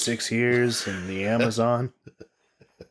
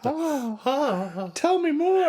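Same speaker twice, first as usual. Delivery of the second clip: mock awed kid; 14 semitones higher, much slower than usual. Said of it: "they're all mystified".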